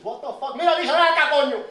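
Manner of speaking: very high, loud enraged yelling; furious dad yelling in Spanish; furious father shouting. Retold or imitated. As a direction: scream at the daughter to come here. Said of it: "Father loses it".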